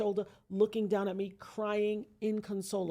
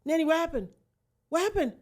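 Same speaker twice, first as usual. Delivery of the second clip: high, louder panicked "what happened"; alarmed sister; 8 semitones higher, 8 dB louder, faster than usual. Retold or imitated. Sister hears her shot sibling on the phone, alarmed. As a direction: retell the sister's rising alarm. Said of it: "she's like".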